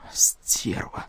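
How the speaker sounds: whispered curse, teeth clenched; through gritted teeth. A narrator's reading. Major cursing the woman he gives in to, through gritted teeth. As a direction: squeeze the insult out under the breath. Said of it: "сквозь зубы процедил майор".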